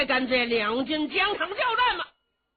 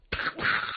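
hammer clash sound effect. Acted.

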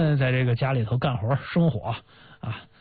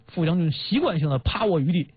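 usual speaking voice

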